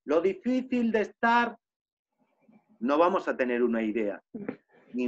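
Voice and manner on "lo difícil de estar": mock yell to mountains. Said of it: "aunque gritemos a las montañas".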